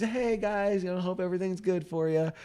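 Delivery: high, soft little server voice; child playing waiter. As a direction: imitate a small kid checking on tables. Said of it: "acting like I was a waiter".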